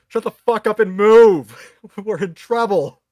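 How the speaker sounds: loud, high, slow panicked yell; shouting. Acted. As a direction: yell at them to run. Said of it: "hear Ferris just shouting".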